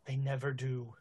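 low, quiet, resigned priest; a weary priest's quiet reply; sad priest. Acted. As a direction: let the line sink, sadly. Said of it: "with a sad eyes".

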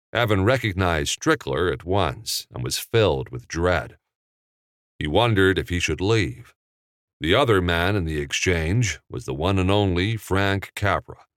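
ordinary delivery, nothing marked

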